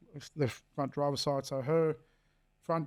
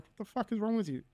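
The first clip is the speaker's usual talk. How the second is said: higher-pitched indignant retelling, fast; retold anger; 7 semitones higher than usual. Dad telling off the ex for yelling at the child, high-pitched indignation.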